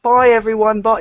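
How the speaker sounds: high, loud singsong goodbye; mock farewell chorus. Host waving off viewers, mock high farewell. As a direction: call it out high, hammed-up.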